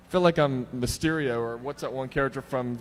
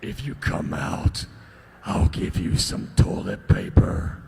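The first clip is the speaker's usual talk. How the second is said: low, slow menacing taunt; creepy killer; 11 semitones lower, slower than usual. Acted. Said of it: "I'll be the bad guy or the serial killer".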